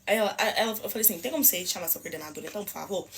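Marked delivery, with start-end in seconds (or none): 1.2-3.1 low, soft and controlled; polite request. Retold or imitated. Customer asking for the coordinator, low polite request. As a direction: ask quietly, keeping your composure.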